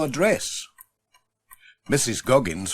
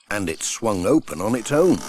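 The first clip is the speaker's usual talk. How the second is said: ordinary delivery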